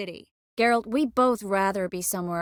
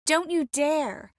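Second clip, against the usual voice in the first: indignant warning; 6 semitones higher, slower than usual. Acted. Warning someone off.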